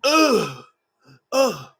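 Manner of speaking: imitating a timid Joker moaning; mock whimpering moans. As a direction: whimper it like a scared lover. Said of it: "this little sex scene where he's like".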